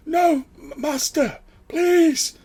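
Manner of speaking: very high, loud, cracking plea; a broken servant begs on his knees; desperate begging. Acted. Dying servant begs the departing master, terrified. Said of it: "he's like".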